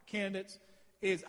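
normal talking voice

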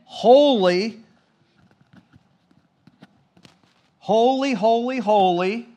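loud, high cry of holy; ringing shout of praise; seraphim shouting. Acted. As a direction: call it out like the seraphim. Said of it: "They are shouting at the top of their lungs".

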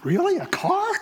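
high-pitched mock disbelief; comic, high incredulity; incredulous. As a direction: squeak it out as a baffled question. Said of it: "I go".

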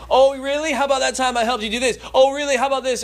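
high, loud, mock-indignant retort; a pretend comeback he never said; imagined sarcastic father. Father pretending to fire back at son, sarcastic. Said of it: "could have went through a list".

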